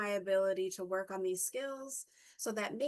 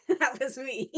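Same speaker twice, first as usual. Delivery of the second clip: says it through a burst of laughter, louder; laughing; 3 semitones higher, 8 dB louder than usual. Real, not acted. To viewers about her eighties outfits, laughing. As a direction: laugh it out, owning it.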